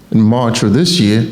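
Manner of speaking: ordinary talk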